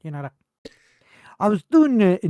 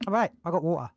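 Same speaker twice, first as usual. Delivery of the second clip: ordinary delivery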